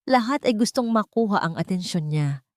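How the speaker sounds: ordinary delivery, nothing marked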